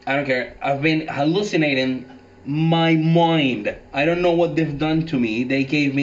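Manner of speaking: agitated. Acted. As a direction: insist in loud panic. Moses refuses, agitated.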